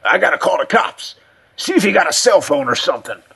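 high, hoarse, frantic voice; panicking old man. Acted. Cut and Shoot backs away wanting the cops, panicky. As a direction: blurt it in rising alarm.

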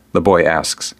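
plain reading voice

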